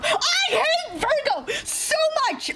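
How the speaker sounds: high, slow, wailing complaint; frustrated cry. Real, not acted. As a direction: cry out your hate for the villain. Venting about a villain.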